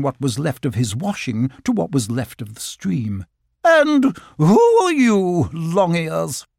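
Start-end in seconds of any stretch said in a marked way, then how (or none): 3.6-6.4 high, loud, drawn-out grand challenge; haughty otter. A narrator's reading. Otter demanding who the rabbit is, haughty. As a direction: look down on them with a slow, high demand. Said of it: "she added in a haughty voice".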